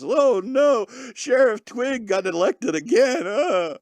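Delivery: high, drawn-out fake weeping; mock crybaby wailing. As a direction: wail in hammed-up despair. Mocks crying over local elections.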